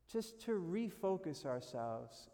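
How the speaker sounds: ordinary delivery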